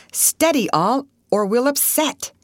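sharp call. A narrator's reading. Russ warning the others in a rocking boat, sharp call. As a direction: call it out sharply to steady everyone. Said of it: "Russ sharply called".

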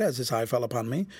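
usual speaking voice

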